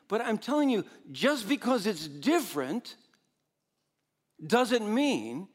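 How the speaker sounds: ordinary delivery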